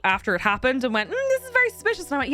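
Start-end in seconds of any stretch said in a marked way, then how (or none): 1.1-2.0 high, sing-song mock bank clerk; mock suspicious clerk. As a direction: pitch it up, mocking their suspicion. Mocking the bank's suspicion.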